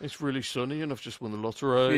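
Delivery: mock-glum Birmingham accent; miserable Birmingham accent. Brummie sharing happy news, miserable Birmingham accent. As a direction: deliver great news in a dreary Birmingham lilt. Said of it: "like, really miserable".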